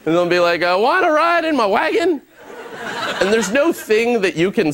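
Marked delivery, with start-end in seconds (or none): 0.6-2.2 put-on high, childish, odd voice; a mock crazy man, pitched high; goofy street stranger. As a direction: ask like an odd grown-up child.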